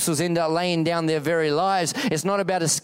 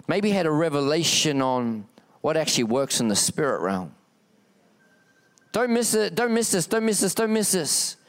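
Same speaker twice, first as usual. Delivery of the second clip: normal talking voice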